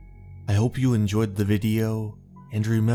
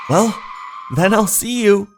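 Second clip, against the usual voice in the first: high, loud parting words; raised-voice farewell; 11 semitones higher, 4 dB louder than usual. Acted. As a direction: call out a quick goodbye, pitched up. Character calls out a parting.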